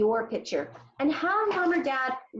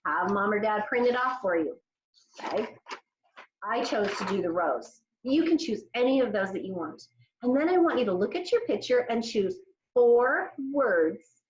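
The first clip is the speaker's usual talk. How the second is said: normal talking voice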